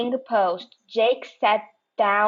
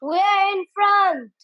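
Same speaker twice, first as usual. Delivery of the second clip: much higher triumphant cry; triumphant yell; 10 semitones higher than usual. Acted. Giles overtaking the panthers, triumphant yell. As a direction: crow with victory. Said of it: "Giles yelled triumphantly".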